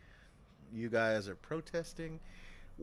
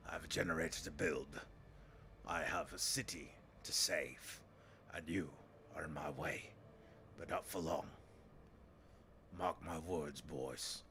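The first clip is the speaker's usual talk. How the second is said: menacing city leader; 4 semitones lower than usual. Acted. Leader to striking workers, low and menacing.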